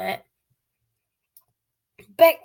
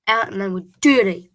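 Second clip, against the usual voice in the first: ordinary delivery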